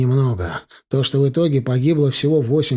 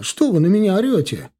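higher, indignant retort; indignant protest; 6 semitones higher than usual. Acted. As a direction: snap back indignantly. Conductor protests being yelled.

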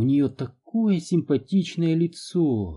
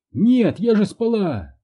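high, loud, slow protest; indignant; 8 semitones higher, 4 dB louder than usual. A narrator's reading. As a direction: snap back indignantly. Mother protests, mouth burning.

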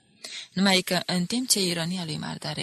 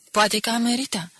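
quick comeback, a little louder and higher; prompt reply; 5 semitones higher, 5 dB louder than usual. A narrator's reading. Mardare answers Barbu's irony at once, promptly. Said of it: "răspunse prompt Mardare".

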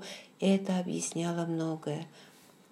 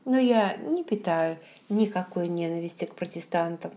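usual speaking voice

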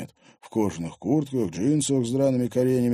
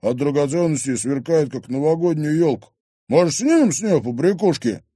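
loud, higher jeering banter; brazen thug; 6 semitones higher, 6 dB louder than usual. Acted. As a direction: jeer with greedy swagger. Thug's character voice.

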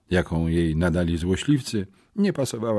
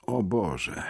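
jęk; 2 semitones higher, 6 dB quieter than usual. Acted. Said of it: "jęknąłem".

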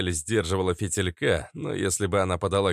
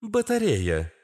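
high-pitched obedient female reply; 11 semitones higher, slower than usual. A narrator's reading. Astra repeats the word for Katz, high obedient voice. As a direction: repeat it dutifully, high and clean. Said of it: "послушно повторила Астра".

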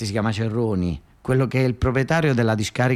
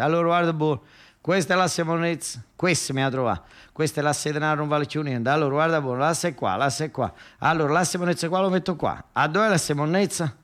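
higher, hustler's fast-talk call; street hustler patter; 6 semitones higher than usual. Acted. Nicola hustling passers-by with a higher street-hawker patter. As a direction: lure the mark to the cards. Nicola hawking three-card game.

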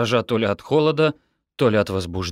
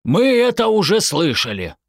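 louder, higher interruption; cutting in; 11 semitones higher, 5 dB louder than usual. A narrator's reading. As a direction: break in impatiently over the speaker. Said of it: "прервал её отец".

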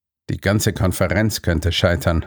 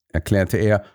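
ordinary delivery

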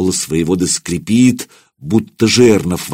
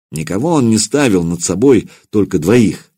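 plain reading voice

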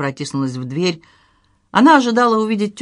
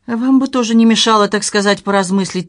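plain reading voice

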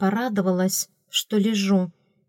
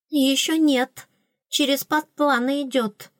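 queen whispering; 6 semitones higher than usual. A narrator's reading. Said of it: "шепнула мне королева".

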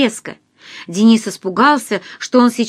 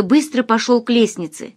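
usual speaking voice

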